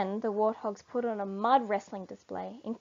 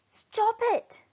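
much higher squeaky cry; 13 semitones higher, 4 dB louder than usual. Acted. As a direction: squeak it out to stop the quarrel. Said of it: "in a squeaky voice".

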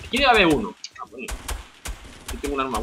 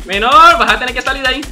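louder, higher mocking call; loud taunt; 5 semitones higher, 10 dB louder than usual. Real, not acted. Streamer taunts an opponent stuck near his trap.

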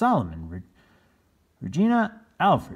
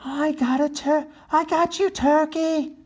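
high loud gleeful cry; triumphant shout; 5 dB louder than usual. Acted. Chris shouting as he lands on Lavinia. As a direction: crow it as you pounce. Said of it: "he shouted".

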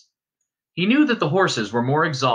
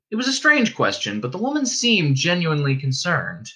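plain reading voice

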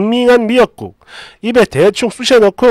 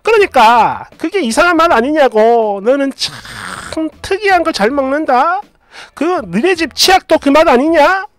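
higher, pointed mother-in-law voice; nitpicking mother-in-law; 6 semitones higher than usual. Acted. Mother-in-law mocks daughter-in-law's taste, pitched-up nitpicking.

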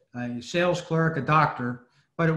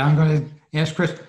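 normal talking voice

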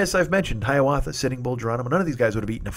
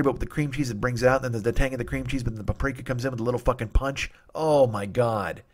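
ordinary talk

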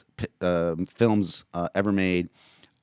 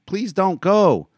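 put-on high, slow, louder child's plea; pleading child crying out; begging boy; 10 dB louder, slower than usual. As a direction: beg her not to leave. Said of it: "began to cry again".

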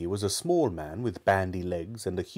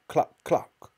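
hen clucking; 6 semitones higher than usual. A narrator's reading. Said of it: "went cluck".

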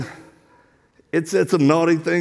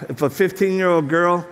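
ordinary talk

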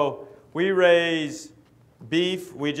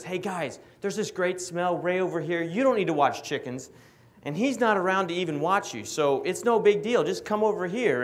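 higher-pitched coaxing tempter voice; bad angel dog; 6 semitones higher than usual. Devil dog luring the other dogs off chickens, bad angel. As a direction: talk like a sly little tempter, pitched up. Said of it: "he's the bad angel that sits over here".

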